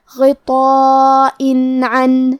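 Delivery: غطاء عن very slow, full heavy غ, nun pronounced clearly without nasal hum; slow tajweed demo of غطاء عن; clear izhar. Shown as a demonstration. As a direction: stretch غطاء then say عن with a plain clear nun. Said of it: "تنوین ظاہر کریں گے اور اسے نون ساکن پڑھیں گے".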